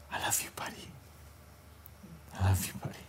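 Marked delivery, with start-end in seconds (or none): 0.1-0.9 unvoiced whisper, very soft. Pastor praying for Grant, whispered. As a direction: breathe it without voice. Repeated line, first take whispered.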